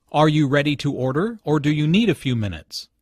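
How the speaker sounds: two-choice question, climbing on "order", falling on "minutes"; rise then fall. Shown as a demonstration. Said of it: "Notice the rising intonation on "order".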